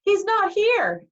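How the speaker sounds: high, loud, slow yell; Annabeth yelling. A narrator's reading. Girl to the whip-lashing Furies, high yell. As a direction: call it out loudly, high and drawn out. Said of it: "Annabeth yelled".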